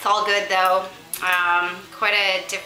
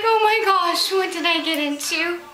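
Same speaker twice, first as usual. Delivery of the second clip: mock dismay; 10 semitones higher than usual. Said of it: "And Sandra's like".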